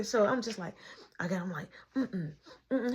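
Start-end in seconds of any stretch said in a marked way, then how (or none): 2.0-2.9 soft unvoiced shush; hushing. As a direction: shush him. Covering kid's mouth.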